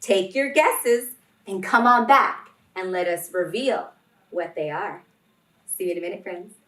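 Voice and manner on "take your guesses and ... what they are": ordinary delivery, nothing marked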